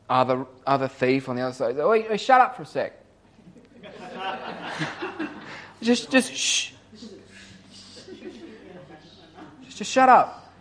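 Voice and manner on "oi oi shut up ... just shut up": much higher, sharp call to shut up; yelled hush. Retold or imitated. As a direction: hush the other thief across the crosses. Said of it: "he yells out at the".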